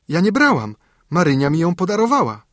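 put-on a frightened child insisting she is innocent; child defending herself to the nanny; pleading denial. Child denies theft.

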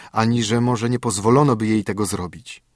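plain reading voice

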